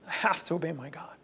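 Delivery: quietly regretful but firm; humble apology. Retold or imitated. Apostles answering rulers, softly and humbly. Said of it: "It's always like".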